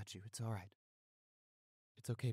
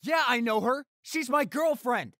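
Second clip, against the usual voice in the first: angry shout; 13 semitones higher, 13 dB louder than usual. Acted. Confronting attackers.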